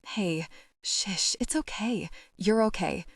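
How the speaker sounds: a soft, hushing murmur to calm her; soft soothing hush. A narrator's reading. Ren soothing a crying Frankie in the van, soft hush. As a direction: gently shush a crying friend.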